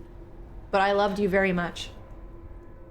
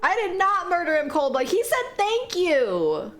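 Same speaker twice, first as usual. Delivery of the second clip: high drawn-out protest; 8 semitones higher than usual. Real, not acted. Defending herself to chat.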